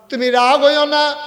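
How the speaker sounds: high, loud desperate acted plea; tearful pleading. Woman begs Omar's forgiveness, high wailing plea. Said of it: "মহিলা কান্দে আর কয়".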